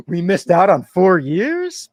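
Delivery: loud, high, drawn-out disbelief; mock shocked audience. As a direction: imitate a stunned crowd, stretching the words out. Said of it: "the theater gasped".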